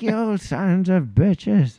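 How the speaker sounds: very slow, high put-on grumble; vengeful listener. Host voices their last listener cursing them, slow mock menace. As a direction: drag it out as an aggrieved old foe.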